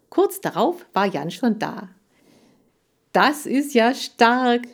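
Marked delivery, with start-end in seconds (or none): 3.1-4.7 high, loud, drawn-out exclamation; enthusiastic shout. A narrator's reading. Boy storms into the room, enthusiastic shout. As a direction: cry out slowly, thrilled by the news. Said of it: "rief er begeistert".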